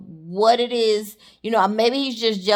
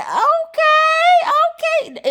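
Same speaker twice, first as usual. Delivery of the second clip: much higher, squealed approval; impressed crowd; 4 dB louder, much slower than usual. Retold or imitated. Crowd reacting to the rapper wearing the shoe, high and squealing. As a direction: hoot it up like the crowd won over.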